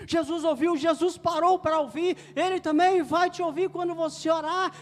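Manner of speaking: preaching at full force. Real, not acted. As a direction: proclaim it fervently to the congregation.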